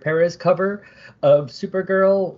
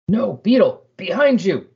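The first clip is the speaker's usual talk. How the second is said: high-pitched mock warning cry; urgent warning; 6 semitones higher, 4 dB louder than usual. Comic reader voicing a warning to Beetle, high and urgent. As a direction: warn them in a raised, urgent voice.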